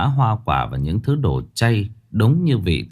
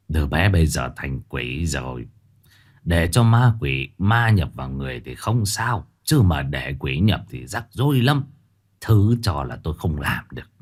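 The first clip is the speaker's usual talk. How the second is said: thầy bói từ chối; 5 semitones lower than usual. A narrator's reading. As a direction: decline gently and gravely. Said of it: "Thầy lắc đầu mà rằng". (giọng thầy trầm, từ chối)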